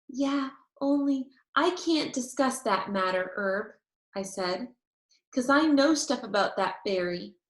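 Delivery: usual speaking voice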